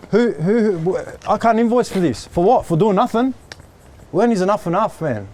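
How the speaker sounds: high-pitched, fed-up outburst; exasperated rant. Real, not acted. Venting to a coworker about wasted days, high exasperated outburst. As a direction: near breaking point, pitch climbing up high.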